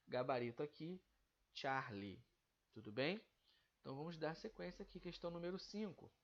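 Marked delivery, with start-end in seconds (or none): none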